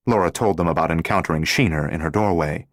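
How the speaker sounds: usual speaking voice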